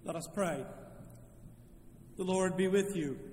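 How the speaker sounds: normal talking voice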